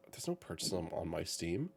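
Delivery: low, hushed thinking aloud; retold puzzlement. Retelling a check of the Steam app, quietly puzzled. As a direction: puzzle over it under your breath.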